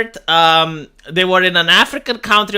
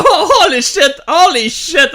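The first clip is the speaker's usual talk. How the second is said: laughing, higher and louder burst of surprise; shocked laugh; 8 semitones higher, 5 dB louder than usual. Real, not acted. Reacting to the king's portrait, laughing shock. Seeing a painting on screen.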